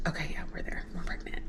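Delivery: soft, near-whispered aside; whispered self-talk. Retold or imitated. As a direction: drop to a hushed murmur. Retold private reassurance to herself.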